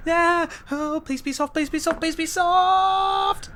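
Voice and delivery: high, frantic, squealed begging; scream-plead, stretching the last word. Acted. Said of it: "As he's screaming".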